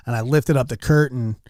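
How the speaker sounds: usual speaking voice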